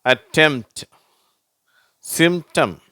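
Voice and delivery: அட்டெம்ப்ட், சிம்டம் with m straight into t; isolated model words, no p sounded; silent P demo. Shown as a demonstration. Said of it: "பீன்ற உச்சரிப்பு வரதில்லை".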